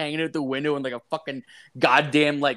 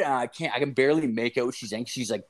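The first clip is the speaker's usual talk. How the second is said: ordinary talk, nothing marked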